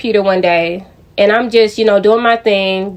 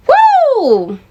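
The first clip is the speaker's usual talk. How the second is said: exasperated whoop; 14 semitones higher than usual. Real, not acted. Vlogger reacting to the wild lie, exasperated whoop. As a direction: let out a long, high whoop.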